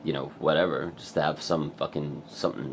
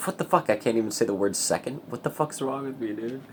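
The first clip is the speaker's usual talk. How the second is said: baffled self-mockery; 7 semitones higher than usual. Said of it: "and you're like".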